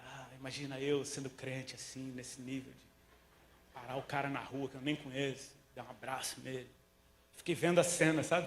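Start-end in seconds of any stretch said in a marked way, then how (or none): 0.0-6.7 soft, slow musing; a runner quietly mocking his own idea; inner thought.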